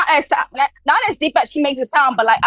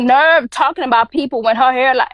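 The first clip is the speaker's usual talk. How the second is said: ordinary delivery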